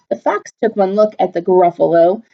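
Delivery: usual speaking voice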